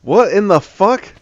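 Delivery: high startled outburst; shocked exclamation. Real, not acted. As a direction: blurt it out in shock. Stumbles on strange cave build.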